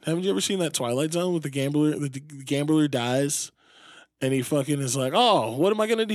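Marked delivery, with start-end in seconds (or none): 5.1-6.1 higher, louder mock amazement; higher-pitched put-on exclamation; retold wonder. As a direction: act out the gambler's wonder. Acting gambler in retold episode.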